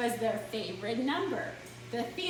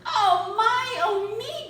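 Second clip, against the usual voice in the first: breathless gasp, higher and louder; 9 semitones higher, 8 dB louder, slower than usual. Acted. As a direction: gasp it out in alarm. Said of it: "gasped Douglas".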